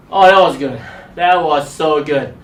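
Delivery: normal talking voice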